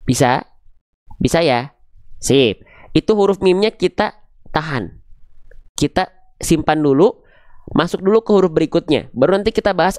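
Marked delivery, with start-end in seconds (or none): none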